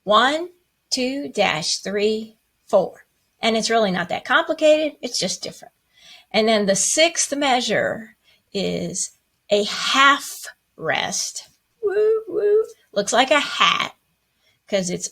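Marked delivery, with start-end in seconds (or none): 0.0-3.1 teacher spacing out one, two, dash, three, four; counting the beats. Shown as a demonstration. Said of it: "you would count it". 11.8-12.6 playful woo. Acted. Playful cheer in lesson.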